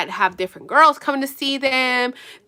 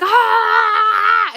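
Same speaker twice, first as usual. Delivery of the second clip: a sudden, much higher and louder shriek; panicked scream; 11 semitones higher, 6 dB louder than usual. Retold or imitated. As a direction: let out a panicked shriek as she speeds. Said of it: "She's like".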